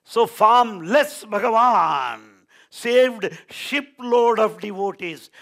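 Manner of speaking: loud, high, slow preaching; forceful proclamation. Real, not acted. Preacher sums up the ship miracle for listeners, ringing proclamation. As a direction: proclaim it at full voice, stretching the words.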